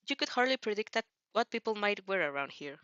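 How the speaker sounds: plain reading voice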